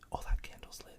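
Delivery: breathy ASMR whisper. Acted. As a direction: whisper close to the mic. ASMR candle lighting.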